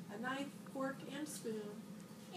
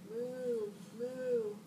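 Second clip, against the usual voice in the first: cow mooing; 3 dB louder than usual. A narrator's reading. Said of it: "said the spotted cow".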